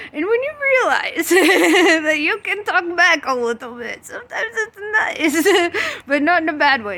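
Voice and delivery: high giggly voice breaking into laughter; laughing while talking. Real, not acted. Telling her audience about talking back to God, laughing. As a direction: giggle through it.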